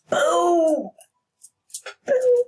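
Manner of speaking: high, punchy boom; triumphant high exclamation; gleeful boom. Real, not acted. Tower card drawn.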